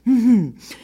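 high, affected little chuckle; laughing again. A narrator's reading. Peacock still amused by the three animals, high titter. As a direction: let a last titter out. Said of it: "laissez-moi rire encore".

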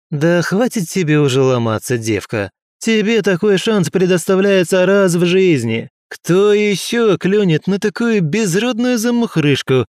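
scornful rich bully talking down; arrogant aristocrat. Acted. Arrogant aristocrat harassing girl.

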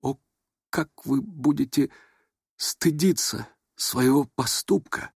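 slow, halting, wounded reproach; breaking voice. A narrator's reading. The prince facing the wall after the slap, faltering. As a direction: let each phrase break off. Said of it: "прерывающимся голосом проговорил".

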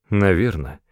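ordinary delivery

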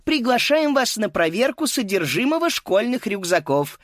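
high, cheerful PA voice; cheerful announcer. Acted. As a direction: announce it merrily into the mic. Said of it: "весело звучал голос в микрофон".

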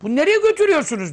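high, rushed question of the unwilling dead; protesting cry. Acted. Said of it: "diye bağırmasını".

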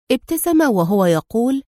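plain reading voice

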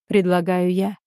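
ordinary delivery, nothing marked

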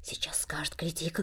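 hushed aside; whisper. Acted. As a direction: whisper it in the boy's ear. Semyon nudging the boy.

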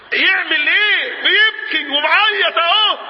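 high, slow cry of emphasis; loud exclamation. Acted. Preacher tells the crowd the man is weeping, exclaiming loudly. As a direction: call it out high, stretching each word.

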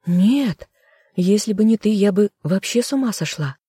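words break as she protests. A narrator's reading. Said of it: "Голос сорвался".

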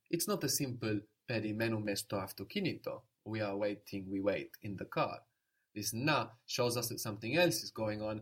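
ordinary talk

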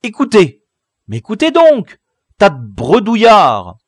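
loud, high demand for attention; scolding cry from above; exasperated shout. A narrator's reading. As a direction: yell at them to listen. Lookout scolds chattering boys.

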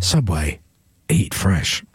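deep voiceover leaning on "eat"; low tagline with "eat" emphasised; stress on eat. Shown as a demonstration. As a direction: put the weight on "eat" in a low ad voice. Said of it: "more emphasis on the word eat".

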